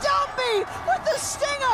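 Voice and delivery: high, stretched hype call; excited shout. Real, not acted. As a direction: yell the play out, drawn long. Caster calling a big play.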